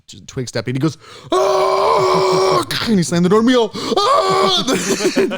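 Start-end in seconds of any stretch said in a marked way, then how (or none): 1.3-2.6 high, loud mock scream of terror; sudden long high scream. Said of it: "We all screamed too". 3.7-5.4 high, loud mock shriek; answering scream, loud and very high; group scream. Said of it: "We all screamed too".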